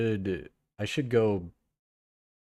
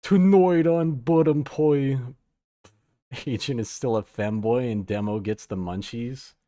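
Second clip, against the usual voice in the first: mock trailer announcer; 7 dB louder than usual. Reading chat as trailer announcer.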